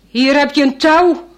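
loud call down to the boat. Acted. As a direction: call down over the wind. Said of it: "roept die visserman".

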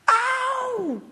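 sharp high scream of pain. Acted. Goa hit in the eye, screaming in pain. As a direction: cry out suddenly as if hit in the eye. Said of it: "een angstige schreeuw".

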